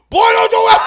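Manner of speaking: high, loud scolding shout; mock furious yell. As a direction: bark it like an angry preacher dad. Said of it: "He was like".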